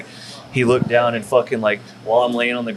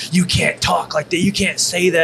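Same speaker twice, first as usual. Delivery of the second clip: mocking scolding critics; 7 semitones higher than usual. Internet critics scolding him.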